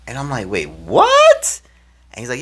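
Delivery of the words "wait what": high, loud disbelieving "what"; astonished reaction. Retold or imitated. Player reacting to name announcements on the field, astonished. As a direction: burst out in surprise. Learns names will be announced.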